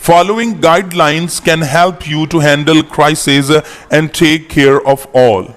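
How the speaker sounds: slow, careful English reading for students; slow reading aloud. Shown as a demonstration. Teacher reading the English paragraph slowly to the class. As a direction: read each word slowly so learners follow. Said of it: "repeat करता हूं".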